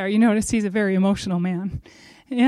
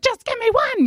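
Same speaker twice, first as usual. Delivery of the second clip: very high-pitched, squeaky begging; desperate mock plea. Single woman pleading for one child, high mock begging. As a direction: beg in a tiny, high voice.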